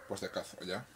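ordinary talk, nothing marked

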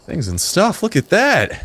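a gasp, then high thrilled squeal; hammed-up delight; mock delight. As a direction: overplay the joy of the gift.

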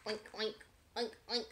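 grunting pig oinks. Acted. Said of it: "grunted the pig".

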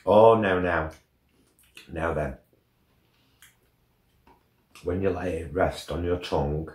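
sucking on a sweet; speaking while tasting; chocolate in mouth. Real, not acted. Seen 3: Right man's hand at lips, mouth pursed, tasting chocolate throughout.

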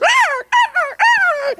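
high-pitched vulture cries; drawn-out bird squawks; vulture screech. Acted. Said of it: "just like vultures".

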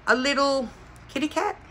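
high, small voice; cutesy cooing. Real, not acted. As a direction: coo over something tiny and cute. Cooing over cute kitty item.

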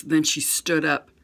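ordinary delivery, nothing marked

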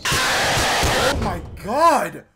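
slow, loud, high "Oh my god"; scared exclamation. Real, not acted. Player recovering from a scare, loud shocked cry. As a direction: stretch it out in shock.

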